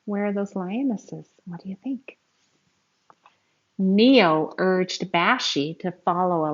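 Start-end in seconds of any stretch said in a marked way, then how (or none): none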